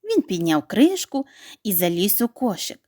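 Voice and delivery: ordinary delivery, nothing marked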